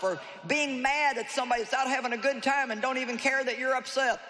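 preacher driving the question home; preaching at full force. Acted. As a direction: press the questions on the congregation. Preaching the call of God.

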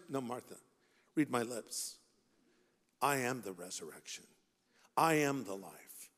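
low, soft, measured assertion; quiet correction. Acted. Jesus correcting Martha about the resurrection, in quiet correction. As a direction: drop the voice and spell it out gently.

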